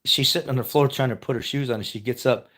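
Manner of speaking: ordinary delivery, nothing marked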